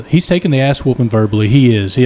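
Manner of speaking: ordinary talk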